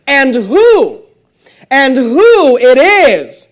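"who" drawn out high and loud; slow, ringing emphasis on "who"; stress on WHO. Shown as a demonstration. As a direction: hit "who" hard and stretch it, then "is". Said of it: "but notice the emphasis".

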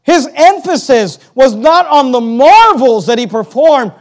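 loud, high, driving sermon delivery; fiery pulpit voice; full-force preaching. Acted. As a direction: preach it hard to the congregation.

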